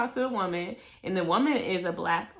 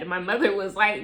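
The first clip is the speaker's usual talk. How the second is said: ordinary talk, nothing marked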